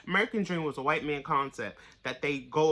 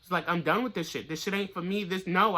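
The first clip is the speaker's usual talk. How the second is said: ordinary delivery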